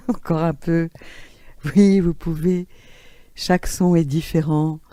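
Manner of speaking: normal talking voice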